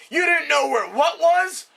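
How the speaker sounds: father exploding at his son; outraged disbelief. Acted. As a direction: bellow it in stunned outrage.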